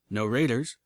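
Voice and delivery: ordinary delivery, nothing marked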